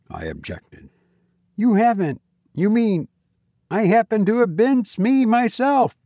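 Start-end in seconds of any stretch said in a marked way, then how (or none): 1.6-5.9 high-pitched youthful disbelief; high, indignant young man's voice; incredulous young man. A narrator's reading. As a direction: voice an astonished young player, pitched well up. Young Reggie answers in disbelief.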